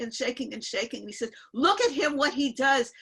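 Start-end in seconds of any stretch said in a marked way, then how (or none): 1.5-2.9 raised, louder mock scolding; retold yelling. Guru pointing out a poor shaking man, retold yelling. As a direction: imitate the guru yelling about someone. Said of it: "he started yelling at him".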